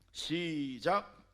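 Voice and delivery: very slow, stretched 시작; drawn-out cue. Real, not acted. 회중에게 함께 읽기 시작하라고, 느리게 끈 신호. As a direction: drag out 시작 to start the reading. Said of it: "cue for congregation to read".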